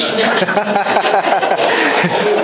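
chuckling along; laughing. Real, not acted. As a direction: still laughing. Audience laughs at treasurer joke.

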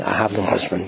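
low, offhand denial; playing it down. Shown as a demonstration. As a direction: toss it away to dodge the subject. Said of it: "I tried to read it like that".

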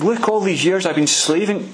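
a bitter son airing his grievance; indignant complaint. Retold or imitated. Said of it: "he says to his father".

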